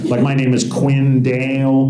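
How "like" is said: ordinary talk, nothing marked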